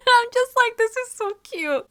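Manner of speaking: high giggly squeal; laughing. Real, not acted. Fan reacting to a cute anime moment, laughing.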